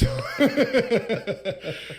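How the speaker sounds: high burst of real laughter; laughing. Real, not acted. Podcast guest cracking up over a forgotten movie, laughing.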